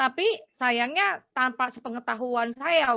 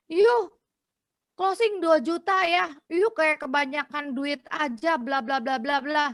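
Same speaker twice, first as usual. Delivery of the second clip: mock nagging wife, higher and louder; angry wife; 5 semitones higher, 3 dB louder than usual. Wife scolding her husband over the big policy, mock annoyed. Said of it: "istrinya marah-marah".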